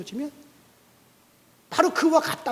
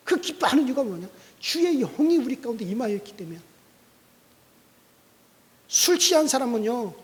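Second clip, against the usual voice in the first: normal talking voice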